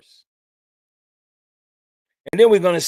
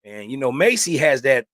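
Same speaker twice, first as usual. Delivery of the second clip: normal talking voice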